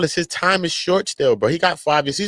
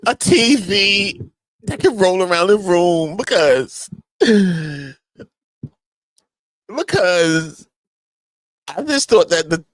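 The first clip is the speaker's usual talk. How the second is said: mock witness testimony; 4 semitones higher than usual. Imitating Jane testifying.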